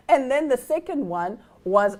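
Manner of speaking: usual speaking voice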